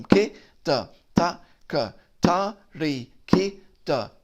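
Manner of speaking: slow, spaced-out syllables. Shown as a demonstration. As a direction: spell each syllable out slowly. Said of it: "it's gonna take a while to get the syllables".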